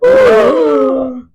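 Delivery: high, startled "Oh"; caught-out gasp. Retold or imitated. Rhys asked about the mates, startled.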